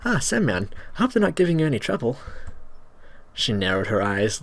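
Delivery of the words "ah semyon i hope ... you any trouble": put-on girl voice. Girl character voiced in story.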